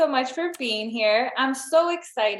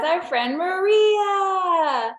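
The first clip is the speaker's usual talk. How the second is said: drawn-out, high cheering announcement; excited introduction; 7 semitones higher, 4 dB louder, much slower than usual. Real, not acted. Host introducing her friend to listeners, excited announcement. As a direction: stretch the name out in a big welcome.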